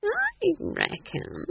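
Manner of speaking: very slow, high drawn-out musing; ponderous, high-pitched old witch; slowly. A narrator's reading. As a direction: draw it out in a high character voice. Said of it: "she said slowly".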